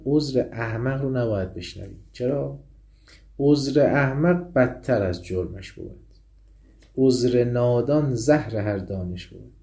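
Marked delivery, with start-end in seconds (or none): 3.4-9.5 slow, louder verse reading; stately recitation; poem recitation. Acted. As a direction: declaim the maxim.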